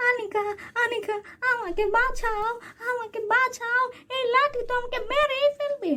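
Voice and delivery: high, frantic aunt begging; aunt being beaten calls to Anika, high and frantic; panicked cry for help. Acted. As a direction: plead high in mock panic. Aunt beaten by stick, cries help.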